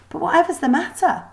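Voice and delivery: put-on high, worried young girl; Lily comforting the upset maid; concerned girl. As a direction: ask gently what's wrong.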